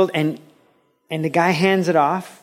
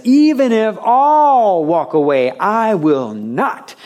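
much higher and louder, slow and proud; emphatic vow; 7 semitones higher, 5 dB louder, slower than usual. Acted. Peter boasting to Jesus he won't fall away, loud emphatic vow. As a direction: declare it like a boastful disciple. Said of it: "He's very emphatic".

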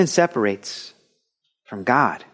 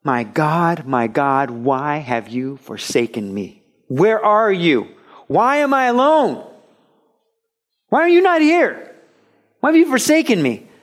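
anguished cry; 3 semitones higher than usual. Acted. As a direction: start hushed, then cry out in abandonment. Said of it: "No, he cried".

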